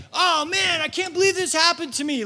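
high, loud whining lament; mock self-pity. Preacher mimics a high, loud whine over hardship. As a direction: make fun of our complaining. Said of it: "when you're like".

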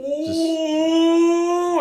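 high, loud, sustained mock ooh; crowd's shocked ooh. Room reacts to the comic's insult, high drawn-out ooh. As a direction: imitate a whole room going ooh.

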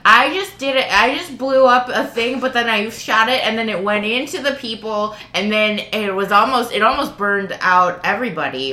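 high, loud, breathless bragging; gleeful confession. Retold or imitated. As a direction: blurt it out proudly, no remorse. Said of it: "Cameron just walked up and was like".

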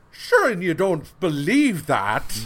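loud, hammed-up stage Irish brogue; exaggerated Irish brogue, mocking; mock Irish accent. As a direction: parody a comic-book Irishman. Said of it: "It's got to be".

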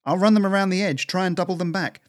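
high, loud squirrel voice; shouted back. A narrator's reading. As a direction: call the plan out while running off. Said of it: "she shouted back".

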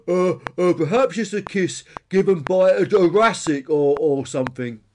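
munching stegosaurus. A narrator's reading. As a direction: guess haltingly with your mouth full. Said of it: "munched the stegosaurus".

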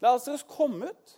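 high, loud call; Jesus calls into the tomb, loud and high. Retold or imitated. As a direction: call Lazarus out of the grave. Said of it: "så roper han inn".

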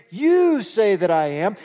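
"You" hit loud and stretched out; emphatic YOU. Shown as a demonstration. Preacher quoting Jesus putting it back on the council, emphatic "You". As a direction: punch "You" slowly, throwing it back at the listeners. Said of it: "it's written emphatically in the Greek".

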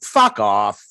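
usual speaking voice

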